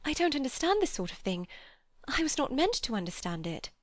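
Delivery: a hint of a frightened girl; distressed young woman. A narrator's reading. Lucy voiced in the story.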